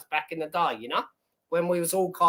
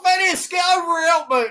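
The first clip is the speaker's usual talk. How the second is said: higher, louder pretend yell for a mate; mock call for help; 9 semitones higher, 3 dB louder than usual. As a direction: shout across the gym for backup, half joking. I'd be like.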